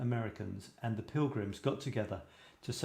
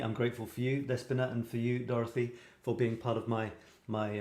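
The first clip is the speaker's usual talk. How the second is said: ordinary delivery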